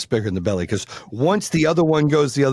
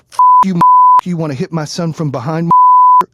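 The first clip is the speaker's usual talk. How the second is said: angry dad shout; 5 semitones higher, 10 dB louder than usual. Retold or imitated. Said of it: "the dad goes".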